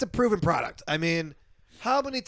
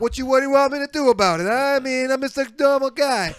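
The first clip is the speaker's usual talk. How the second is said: loud, higher mock Brooklyn working guy; Brooklyn accent; 5 semitones higher, 5 dB louder than usual. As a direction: plead your case as a hammed-up Brooklyn regular guy. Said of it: "he's from Brooklyn for some reason".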